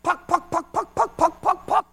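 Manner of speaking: high, loud clucking puck puck; chicken clucking. Retold or imitated. Minister retelling a man's tongues, chicken-like clucking. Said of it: "it sounded like a chicken".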